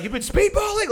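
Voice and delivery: high, incredulous outburst; can't believe what you heard; shocked disbelief. Retold or imitated. Hearing his friend admit it, shocked and high.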